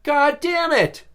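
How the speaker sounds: high, drawn-out mock cursing; acted-out exasperated cry; retold frustration. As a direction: curse in exaggerated dismay. Retold reaction to missed luncheon.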